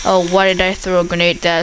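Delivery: ordinary talk, nothing marked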